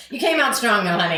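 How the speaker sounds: giggling tease; laughing tease. Real, not acted. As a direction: tease her through a laugh. Teasing friend, laughing.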